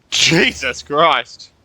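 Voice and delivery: ordinary delivery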